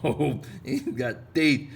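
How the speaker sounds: slow, loud, high laughing; laughing fish voice. Pretend. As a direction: laugh out the teeth line slowly.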